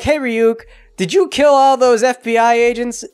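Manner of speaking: upset confrontation. Retold or imitated. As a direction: confront him in a mock-upset voice. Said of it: "Yagami gets really upset".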